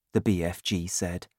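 ordinary delivery

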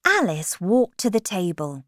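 ordinary delivery, nothing marked

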